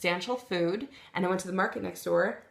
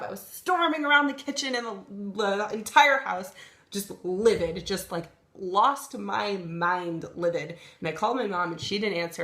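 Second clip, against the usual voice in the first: ordinary delivery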